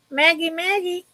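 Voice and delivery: high singsong call to a pet; calling the cat. Real, not acted. Calling the cat off the desk in a coaxing singsong.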